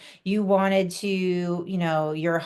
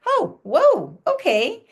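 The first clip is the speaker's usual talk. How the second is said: 1 surprised whoa; 9 semitones higher, 5 dB louder, slower than usual. Retold or imitated. Reacting to partner's bombshell.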